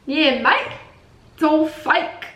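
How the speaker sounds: high, hammed-up Aussie accent; mock Australian accent. Answering fake accent claim, mock Australian accent. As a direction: ham up the Australian for a joke.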